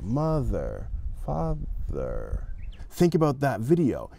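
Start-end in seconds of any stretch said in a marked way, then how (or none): 0.0-2.5 mother, father softly with lips closing; careful th. Shown as a demonstration. Teacher softly demonstrates th for students. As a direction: model the words quietly, lips closing down. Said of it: "you're closing your lips down".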